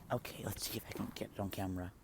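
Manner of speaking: fast hushed whisper. Real, not acted. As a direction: whisper quickly while filming. Hushed while filming.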